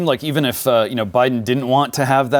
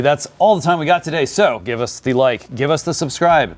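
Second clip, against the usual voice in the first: higher-pitched, emphatic host outro; show sign-off; 7 semitones higher than usual. Acted. Host closes the show for listeners, announcer-style sign-off. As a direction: punch out each sign-off line.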